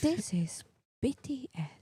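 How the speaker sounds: whispered, slow mock video voice; imitating her friend's soft video voice, whispered; soft-spoken imitation. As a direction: breathe it like a gentle intro. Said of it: "you were like".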